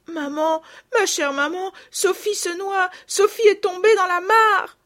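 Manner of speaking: put-on high, loud, tearful little girl; building to a piercing, sobbing shout; despairing cry. A narrator's reading. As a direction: wail the terrible news to your mother. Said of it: "le désespoir de Marguerite".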